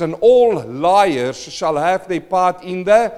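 loud, ringing scripture reading; preaching scripture. Acted. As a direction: proclaim it from the pulpit. Reads scripture at full force.

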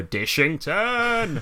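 high, long drawn-out call of the name; sitcom boss shout. Retold or imitated. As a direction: draw the name right out, sitcom style. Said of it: "Proper sitcom style".

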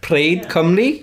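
slow, loud, questioning Welsh echo; imitated Welsh accent. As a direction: repeat it unsurely in a Welsh accent. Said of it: "What was those words?".

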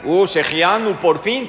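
loud sarcastic welcome; mock the latecomers with an eye-roll. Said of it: "¿Qué te dice el Waze cuando llegaste?". (sarcasmo burlón al que llega tarde)

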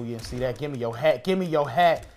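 ordinary delivery

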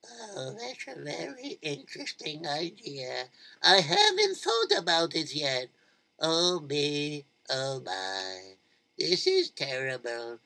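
soft-spoken mother. Acted. Malkele answering her neighbour, low and soft. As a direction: mumble it low, unsure.

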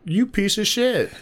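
high, contained mock yell; retold yell. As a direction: replay yelling an insult, pitch jumping up. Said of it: "And I yelled it, 'cause I was like".

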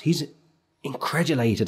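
ordinary talk

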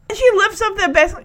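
ordinary talk, nothing marked

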